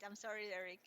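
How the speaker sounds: ordinary delivery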